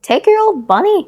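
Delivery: high and loud, bossy nursemaid; a brisk old nurse handing over the toy; Nana's voice. A narrator's reading. Nurse handing the boy his bunny, high and loud. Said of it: "Nana said".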